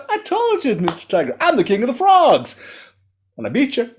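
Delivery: high, squeaky frog brag; smug little storybook king; gloating frog. A narrator's reading. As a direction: lord it over the beaten tiger.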